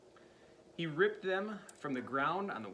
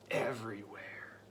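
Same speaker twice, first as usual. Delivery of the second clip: whisper; 5 semitones lower than usual. A narrator's reading. Suspense narration.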